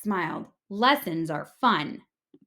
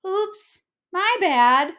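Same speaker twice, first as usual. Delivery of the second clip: sheepish squid; 9 semitones higher, 7 dB louder, slower than usual. A narrator's reading. Squid apologizes after breaking swings.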